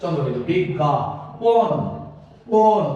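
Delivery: slow demo. Shown as a demonstration. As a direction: spell out বিজ্ঞাপন slowly, punch the last syllable. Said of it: "তাহলে এই দিয়ে দিলাম".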